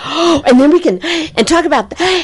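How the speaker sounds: high, rushing, breathless excitement; excited chatter. Retold or imitated. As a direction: gush like an overjoyed kid who can't stop planning.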